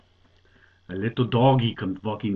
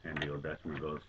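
ordinary delivery

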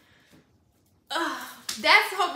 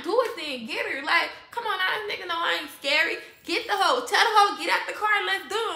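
loud, raised-pitch retold goading; confrontational dare; 6 semitones higher, 6 dB louder than usual. Woman retelling her dare to a rival in a car, confrontational. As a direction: call her out to fight, punching it louder.

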